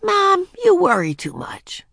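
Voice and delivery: put-on high-pitched, put-upon kid reassuring Mom; exasperated child. A narrator's reading. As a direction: sing it out high, like a parroted complaint. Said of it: "added words she had heard Beezus speak so often".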